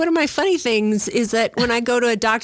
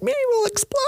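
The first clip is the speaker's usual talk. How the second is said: high, quick and breathless; excited high rush; 13 semitones higher than usual. Pretend. Patient blurting the alien threat to masseuse. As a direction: blurt it out wide-eyed.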